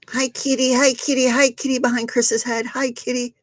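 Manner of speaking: high cooing pet voice. Real, not acted. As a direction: coo at the kitty. Greets cat on video.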